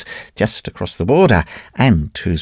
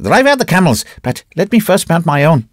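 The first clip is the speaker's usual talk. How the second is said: yelling at servant; 8 semitones higher than usual. A narrator's reading. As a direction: bark at the servant to fetch camels. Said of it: "he yelled at his servant".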